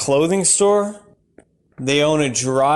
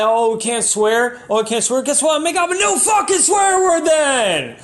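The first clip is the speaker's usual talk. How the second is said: mock furious rant; 10 semitones higher than usual. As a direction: whip yourself into an outraged tirade. Mock rant against word police.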